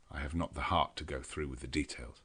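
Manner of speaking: ordinary delivery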